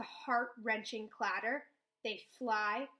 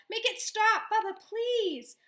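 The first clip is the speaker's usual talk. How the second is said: much higher and louder, slow on please; pleading scream; 11 semitones higher, 9 dB louder, slower than usual. A narrator's reading. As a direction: beg her at the top of your voice. Begs grandmother to stop house.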